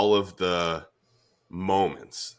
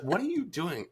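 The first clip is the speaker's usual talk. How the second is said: baffled wife; 12 semitones higher, 4 dB quieter than usual. Retold or imitated. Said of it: "she's like".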